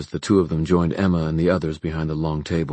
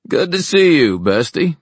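playful greeting; 11 semitones higher, 7 dB louder than usual. Acted. Jace's jokey greeting to Julie.

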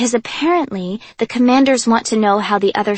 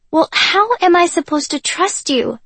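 put-on higher, loud and childlike plea; 9 semitones higher, 5 dB louder than usual. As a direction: voice climbing like a hurt child. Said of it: "my voice rising in pitch like a child".

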